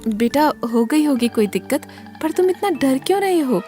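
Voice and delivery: high-pitched motherly voice; parent soothing child. A narrator's reading. Parent answers frightened child. (बेटे को समझाती माँ की ऊँची आवाज़)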